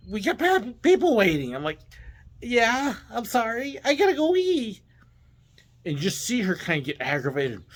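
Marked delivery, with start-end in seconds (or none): 0.1-1.5 higher, louder exasperated protest; exasperated boss. Retold or imitated. Boss to driver holding up the line, exasperated. 2.4-4.8 very high, mock-apologetic plea; childlike pleading. Driver insisting to his annoyed boss, childlike pleading.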